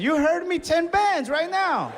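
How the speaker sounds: very high, slow shrill-free squeal of defiance; mock high-pitched boast; hammed-up demand. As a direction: push it way up and drag it out. Over-the-top defiant demand.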